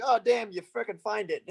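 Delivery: high-pitched, laughing pretend outburst; hammed-up exclamation through laughter; mock dismay. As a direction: play caught red-handed, half laughing. Jokes about being caught with drugs.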